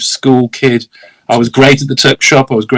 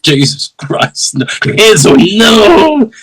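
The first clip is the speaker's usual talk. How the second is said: mock horror; 8 semitones higher, much slower than usual. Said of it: "I went".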